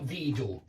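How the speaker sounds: виду with a hard d where zh belongs; mistaken d sound. Shown as a demonstration. Teacher shows the form to avoid, виду with a d. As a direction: say виду with d in the middle. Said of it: "not vidal".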